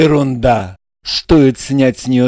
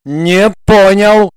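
threatening exclamation; 6 semitones higher, 5 dB louder than usual. A narrator's reading. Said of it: "с угрозой воскликнул Игорь".

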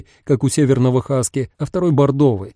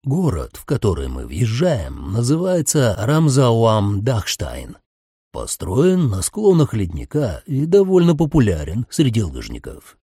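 sinister driver voice; 2 semitones lower than usual. A narrator's reading. Driver's voice held.

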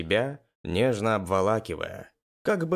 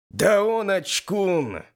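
high, drawn-out teasing; less fervent jeer; 9 semitones higher, 4 dB louder, slower than usual. A narrator's reading. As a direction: stretch the taunt out in a high voice. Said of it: "Уже не так рьяно". (протяжно поддразнивая)

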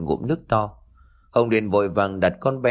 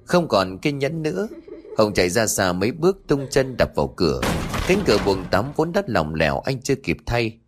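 plain reading voice

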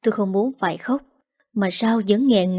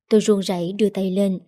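plain reading voice

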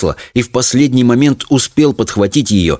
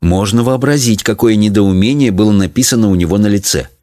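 ordinary delivery, nothing marked

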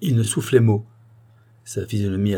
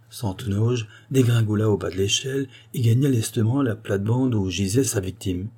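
usual speaking voice

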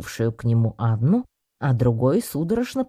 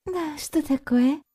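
very high, slow drowsy woman; sleepy mumble; slower than usual. A narrator's reading. Anna on the professor's shoulder, sleepily mumbling. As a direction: answer drowsily in a small high voice. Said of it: "сонно пробормотала та".

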